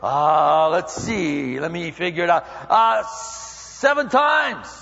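hesitant mock reckoning, then a very slow proud answer; hammed-up eager disciple; Peter figuring it out. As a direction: count it up on your fingers. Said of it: "he goes".

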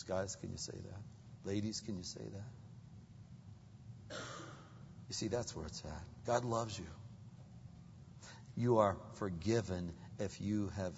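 ordinary talk

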